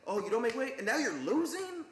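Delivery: incredulous, rising in pitch and slowing at the end; mock a fed-up coach scolding a fighter; retold exasperation. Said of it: "they were legitimately pissed at him".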